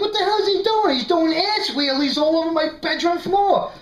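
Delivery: high-pitched, worked-up incredulous rant; mock-exasperated high-pitched outburst; retold flip-out. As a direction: retell your outrage at the dog, pitch jumping up. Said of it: "I flip out. I'm yelling at the dog".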